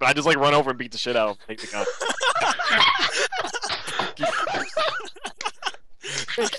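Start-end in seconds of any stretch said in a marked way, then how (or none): 1.9-6.3 bursting out laughing; long laugh. Real, not acted. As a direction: laugh hard and high. Telling funny dream, laughs.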